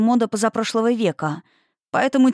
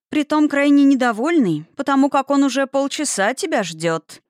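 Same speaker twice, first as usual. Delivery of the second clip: high, louder, pleased teasing; gleeful singsong; 8 semitones higher, 3 dB louder than usual. A narrator's reading. Telling a companion her unhappy client is waiting, gleefully singsong. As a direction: sing it out with mild gloating. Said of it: "Последнюю фразу Ники настолько довольно пропела".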